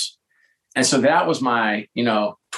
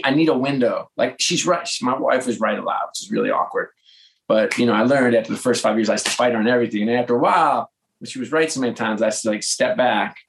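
normal talking voice

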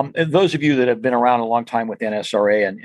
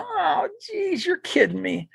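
exasperated groan; 5 dB quieter, slower than usual. Retold or imitated. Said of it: "And I go".

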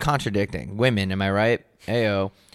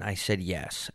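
ordinary delivery

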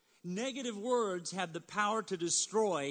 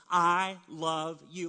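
slow, louder heartfelt I love you; earnest I love you; 6 dB louder, slower than usual. Shown as a demonstration. Pastor leading congregation in saying it, slow and heartfelt. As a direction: say it out, slow and sincere. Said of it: "Let's say that together".

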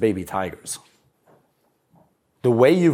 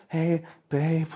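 a touch of a softer, pleading needy man; 4 semitones higher, 4 dB quieter, slower than usual. Retold or imitated. Needy boyfriend chasing girlfriend, lightly voiced soft plea. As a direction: plead for affection like a weak boyfriend. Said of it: "with this weak bitch attitude".

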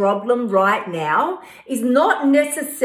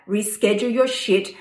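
usual speaking voice

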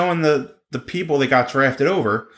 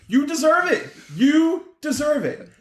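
mock cheer; 11 semitones higher than usual. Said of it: "I was like".

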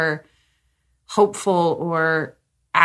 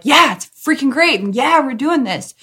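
upbeat partner; 7 semitones higher than usual. Retold or imitated. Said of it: "that you're like".

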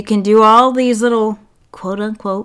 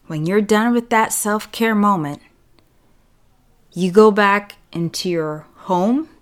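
ordinary talk, nothing marked

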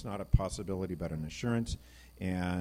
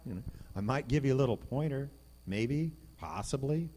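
mock hesitant God; 5 semitones higher than usual. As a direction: play a half-hearted helper, unsure. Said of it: "he doesn't say".

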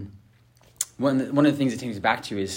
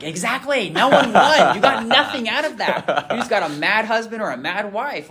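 much higher and louder, laughing through it; laughing exclamation; 12 semitones higher, 8 dB louder than usual. Retold or imitated. Therapist laughing at the couple, higher and louder. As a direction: burst out laughing as you drive the point home. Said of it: "And I'm like".

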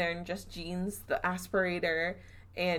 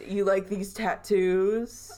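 slow, louder drawn-out challenge; slow mocking taunt; 5 dB louder, slower than usual. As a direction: stretch it out to goad the ghost. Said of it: "immediately after Zach said".

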